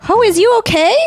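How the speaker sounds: high, loud burst of concern; mock-alarmed check. Real, not acted. Host checking on the sick dog, high mock-alarmed voice.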